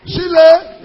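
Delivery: slow, high, loud rallying cry; loud call to clap. Real, not acted. Preacher rousing the congregation to clap for Jesus. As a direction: whip up the church.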